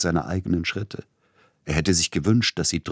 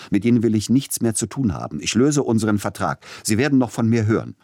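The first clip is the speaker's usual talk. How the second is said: fast, higher, loud angry tirade; agitated outburst; 5 semitones higher, 5 dB louder, faster than usual. Acted. Composer firing his agent, fast and furious. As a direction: rattle off the break-up in fury. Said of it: "in seiner Erregung".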